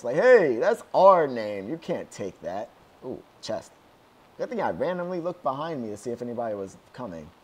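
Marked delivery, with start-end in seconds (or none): 0.2-2.7 loud, drawn-out "hey", then easing; mock pissed-off protest. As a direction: playfully voice offended game creators. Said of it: "like, pissed".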